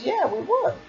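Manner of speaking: high, soft mock insistence; insistent retort. Recapper voices her reply to his doubt, insistent.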